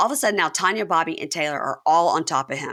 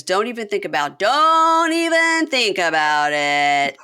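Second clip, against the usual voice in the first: drawn-out slow warning, louder at the end; mock warning; 3 semitones higher, 5 dB louder, slower than usual. Man warning a producer off, drawn out and slow. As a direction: stretch it out like a threat. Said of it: "he looks at her and goes".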